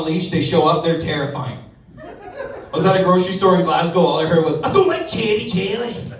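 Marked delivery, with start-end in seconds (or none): 4.6-6.1 put-on high, shouting Scottish kid; Scottish child. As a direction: whine for jelly like a Glasgow kid.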